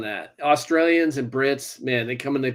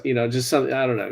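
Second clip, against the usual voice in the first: ordinary talk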